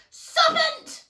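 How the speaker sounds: high piercing scream. A narrator's reading. Pigeon screaming at Alice, a high loud shriek. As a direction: shriek it out at the top of the voice. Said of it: "screamed the pigeon".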